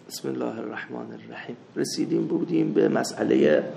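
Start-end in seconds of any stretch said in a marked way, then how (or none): 0.1-1.6 بسم الله الرحمن الرحیم murmured much softer; quiet second بسم الله الرحمن الرحیم; softer repeat. Real, not acted.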